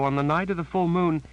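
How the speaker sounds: high, shaken woman's voice; frightened Janet. A narrator's reading. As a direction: say it as the scared sister, plain fear.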